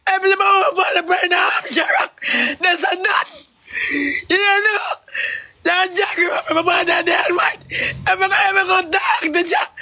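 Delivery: high-pitched crying protest; tearful rant. Real, not acted. Man invoking Moses over the war, crying. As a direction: wail it out through tears.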